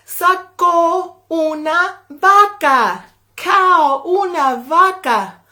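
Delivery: amazed reveal in Spanish; surprise reveal. A narrator's reading. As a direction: savour the reveal. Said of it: "reveal of the cow".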